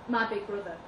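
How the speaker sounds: usual speaking voice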